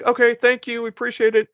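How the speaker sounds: high, sugary thank-you; mock polite thanks. Bill politely thanking the officer.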